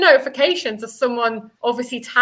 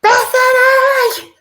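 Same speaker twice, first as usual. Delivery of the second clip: long drawn-out shout of her name, high and loud; calling out; 9 semitones higher, 6 dB louder, much slower than usual. Retold or imitated. Calling her friend over the pub music, drawn-out shout. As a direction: yell the name across a deafening pub.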